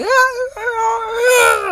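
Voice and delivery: a sudden high-pitched squealing cackle; high shrieking laugh. Real, not acted. Laughing at his own ranting in the car, high shrieking laugh. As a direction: let the laugh break way up high.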